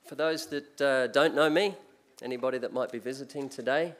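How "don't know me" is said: ordinary delivery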